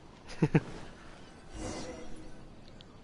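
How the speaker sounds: unvoiced chuckle; laughing. Real, not acted. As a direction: laugh it off after swearing. Laughs after cutting himself off.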